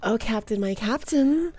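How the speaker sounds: usual speaking voice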